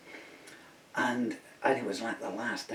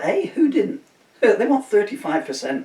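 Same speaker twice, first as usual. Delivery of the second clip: indignant; 11 semitones higher, 12 dB louder than usual. Real, not acted. Indignant rant at strikers.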